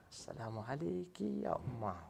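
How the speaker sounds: softly, gently greeting mother; a quiet, loving hello; soft tender greeting. Retold or imitated. Polite greeting set against yelling.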